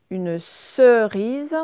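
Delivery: UNE CERISE, slow and loud; teacher sounding out CERISE for pupils; clearly dictated word. Shown as a demonstration. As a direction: dictate CERISE slowly, syllable by syllable. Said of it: "je vous dis les mots".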